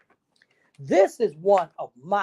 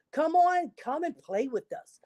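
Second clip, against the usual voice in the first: calling out; 7 semitones higher, 4 dB quieter than usual. Acted. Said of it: "they called".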